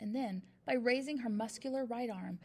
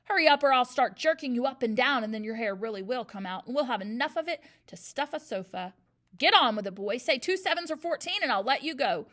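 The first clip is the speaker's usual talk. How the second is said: threatening bellow; 9 dB louder than usual. A narrator's reading. Headmistress threatens dangling boy.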